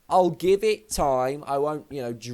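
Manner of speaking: ordinary delivery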